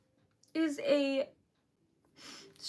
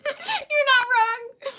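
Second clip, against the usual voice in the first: teary; 10 semitones higher, 8 dB louder, much slower than usual. Real, not acted. Agreeing while crying.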